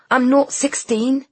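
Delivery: loud fierce retort. A narrator's reading. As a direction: snap back fiercely. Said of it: "said Ainsley fiercely".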